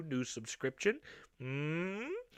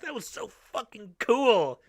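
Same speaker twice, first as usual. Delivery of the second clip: excited; 9 semitones higher, 10 dB louder, slower than usual. Real, not acted. Excited reaction to mask.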